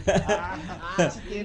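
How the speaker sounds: amused chuckling; soft rolling chuckle; laugh. Real, not acted. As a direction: laugh at your own mimicry. Laughing at own mock pronunciation.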